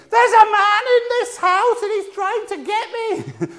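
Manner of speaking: very high, slow mock screaming; drawn-out high-pitched comic scream; mimicked scream. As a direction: shriek like a frightened confused patient, hammed up. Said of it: "she's screaming".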